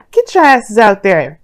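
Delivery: loud, punchy pep-talk order; playful loud urging. Real, not acted. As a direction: shoo them out the door, loudly. Playfully ordering viewers out to flirt.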